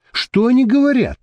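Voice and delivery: high, impatient woman's question. A narrator's reading. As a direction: demand to know, pitched right up. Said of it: "нетерпеливо спросила Мириам". (нетерпеливый вопрос)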